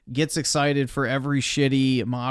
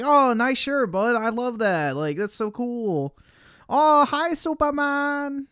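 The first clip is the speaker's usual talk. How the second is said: put-on higher, cooing voice for a small child; talking to a kid; 8 semitones higher than usual. Adult praising a kid's shirt, higher and cooing. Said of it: "walk up to a kid and you're like".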